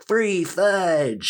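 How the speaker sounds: high-pitched, drawn-out mock yell; retold yell. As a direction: cry out high, without real volume. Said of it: "looked at each other and yelled".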